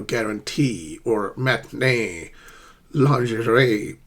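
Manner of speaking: slow "guarantee", "matinee", "lingerie", weight on last syllable; drawn-out French-derived endings in "guarantee", "matinee", "lingerie"; tertiary stress. Shown as a demonstration. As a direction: stretch the final TEE, NEE and RIE of "guarantee", "matinee", "lingerie". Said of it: "they have a tertiary stress".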